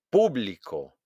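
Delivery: "Público" punched on PÚ; stress on first syllable. Shown as a demonstration. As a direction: hit PÚ in "Público". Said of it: "hago un golpe de voz". ("Público" con golpe de voz en PÚ)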